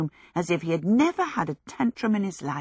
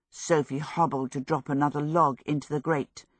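plain reading voice

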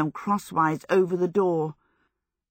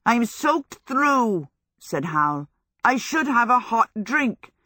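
high, slow, drawn-out whine; plaintive complaint; 6 semitones higher than usual. A narrator's reading. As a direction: complain pitifully, milking your misery. Howl pleads wet and self-pitying.

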